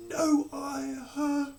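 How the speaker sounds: flustered high stammer; stuttering. Acted. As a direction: caught out, stammering queen. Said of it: "the lady stuttered".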